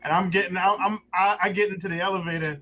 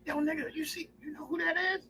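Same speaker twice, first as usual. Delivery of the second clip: hushed excitement; 10 semitones higher, 8 dB quieter than usual. Retold or imitated. Friend whispering in elevator.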